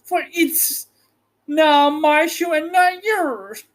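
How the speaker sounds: high, slow witch voice, claiming the shoe; gloating witch. Acted. The witch tells Dorothy the shoe is hers, gloating. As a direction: rub it in like a greedy witch. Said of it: "retorted the witch".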